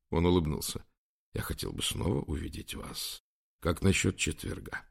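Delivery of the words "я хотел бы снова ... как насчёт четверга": hushed. A narrator's reading. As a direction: keep it private, just for her. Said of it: "спросил шёпотом". (тихо, почти шёпотом)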